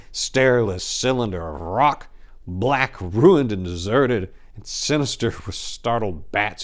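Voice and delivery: loud, slow theatrical declaiming; hammy stage actor. A narrator's reading. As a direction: boom each word like a stage ham. Dramatic Gothic tower description.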